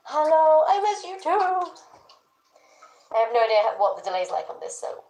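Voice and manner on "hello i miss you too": high, drawn-out sweet hello; affectionate greeting. Real, not acted. Streamer telling a viewer they are missed, high cooing. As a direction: coo it high and slowly.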